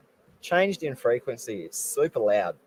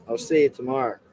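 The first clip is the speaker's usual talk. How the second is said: usual speaking voice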